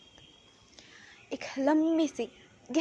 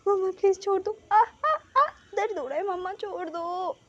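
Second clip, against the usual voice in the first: yelping in pain; 6 semitones higher than usual. A narrator's reading. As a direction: howl for her to let go. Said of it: "wing बस बोले जा रहा था".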